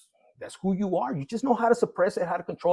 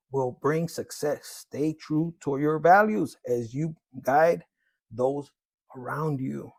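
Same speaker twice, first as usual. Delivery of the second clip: card reading; 3 semitones lower, 5 dB louder than usual. Acted. Reads tarot card text aloud.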